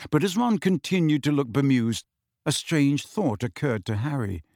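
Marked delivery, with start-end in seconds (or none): none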